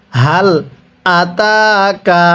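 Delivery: "আতাকা" drawn out very slowly for repeating; slow recitation model. Shown as a demonstration. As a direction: model each word slowly for the class to echo. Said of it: "আরেকবার পড়ুন". (ধীরে টেনে শিখিয়ে পড়া)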